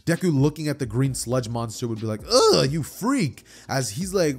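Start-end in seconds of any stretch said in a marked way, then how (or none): 2.3-3.4 high, loud, drawn-out ugh; slow, high-pitched disgust; disgusted recoil. Acted. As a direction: recoil from something revolting. Deku recoils from the monster.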